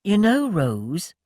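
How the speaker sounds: slow, musing; a man musing aloud to Rose; thoughtful. A narrator's reading. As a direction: draw it out thoughtfully. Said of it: "Tom remarked thoughtfully".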